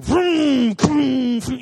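loud high vroom vroom; revving motorboat sounds; boat engine noise. Retold or imitated. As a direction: imitate the boat slamming waves.

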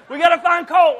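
sudden loud, high alarmed yell; frantic call to find the boy; panicked shout. Retold or imitated. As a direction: shout it like your kid just vanished. Father panicking over lost son.

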